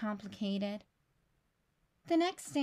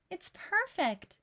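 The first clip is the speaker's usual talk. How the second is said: higher, slow and tender; touched; 7 semitones higher, slower than usual. Acted. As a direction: savour the words with delight. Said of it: "she said".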